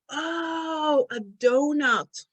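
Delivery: high drawn-out "oh", then slow clear word; sudden realisation. Retold or imitated. Friend finally understanding the word donut, drawn-out realisation.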